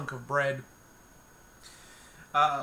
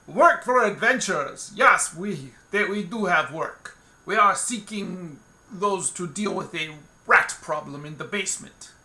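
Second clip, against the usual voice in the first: booming, higher tavern bellow; loud tactless voice; 3 semitones higher, 6 dB louder than usual. Acted. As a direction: announce it to the whole room, no tact. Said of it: "Gostislav is tactless and loud".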